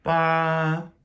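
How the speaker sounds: BA held high and level, sung out; first tone. Shown as a demonstration. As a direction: sing BA on one flat high pitch. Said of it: "the first tone".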